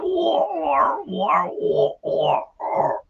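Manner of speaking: high, drawn-out dopey "ohh" moans; village idiot moaning. Acted. Village idiot walking around the farm, moaning. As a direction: moan wordlessly like a simpleton in a joke. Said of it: "a bit of a village idiot".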